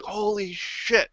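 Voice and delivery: high, slow, drawn-out shocked yell; retold drill sergeant yelling. Drill sergeant spotting the lounging brigade, imitated high yell. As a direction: imitate the drill sergeant stunned by what he sees. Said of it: "starts swearing at".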